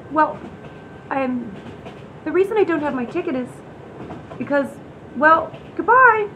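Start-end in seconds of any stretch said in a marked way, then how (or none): 5.2-6.3 slow, loud, high goodbye; a loud drawn-out exit line; sudden loud goodbye. Acted. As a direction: bolt away with a loud slow farewell. Flees from the conductor.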